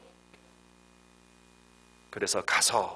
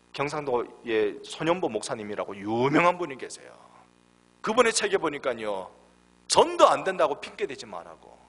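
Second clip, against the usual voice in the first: ordinary delivery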